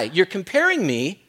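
normal talking voice

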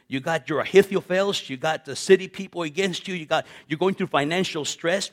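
ordinary delivery

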